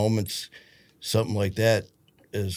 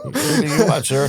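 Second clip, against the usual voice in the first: laughing mock diner; 9 semitones higher, 7 dB louder than usual. Mocking diner staff, laughing.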